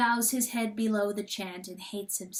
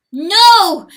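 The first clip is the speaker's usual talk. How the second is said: sharp, much higher and louder cry; yelp; 10 semitones higher, 19 dB louder than usual. A narrator's reading. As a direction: yelp in horror. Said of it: "yelps Chanticleer".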